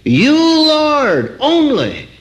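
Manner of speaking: preacher's high emphatic "Lord, only"; stress on Lord and only. Shown as a demonstration. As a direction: lift "Lord" and "only" high to drive them home. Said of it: "Did you get that?".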